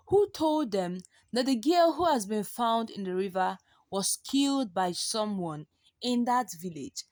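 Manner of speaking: ordinary delivery, nothing marked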